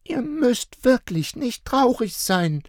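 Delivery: put-on high child voice cheering. A narrator's reading. Little girl cheering up her parents, high and sweet. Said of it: "versuchte Luca, ihre Eltern aufzuheitern".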